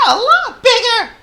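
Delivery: very high, loud tiny mouse; Squeak. A narrator's reading. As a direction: squeal it eagerly as a little mouse. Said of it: "said Squeak".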